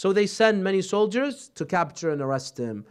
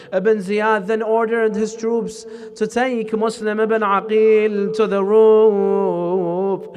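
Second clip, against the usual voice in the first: mourning elegy; 4 semitones higher, 7 dB louder than usual. Acted. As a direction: preach it as a rising lament for the martyr. Preacher builds into elegy recitation.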